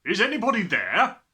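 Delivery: loud, high call into an empty house. A narrator's reading. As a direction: shout it into the silence. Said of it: "He called out".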